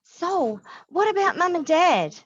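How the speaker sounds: put-on high, loud childish whine; whining boy. A narrator's reading. James whining to his sister about their parents. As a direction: whine like a scared kid. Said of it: "Boy's whining".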